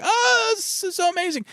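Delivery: mock gushing family. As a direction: coo like everyone is wowed by the pool. Everyone gushing over pool plan.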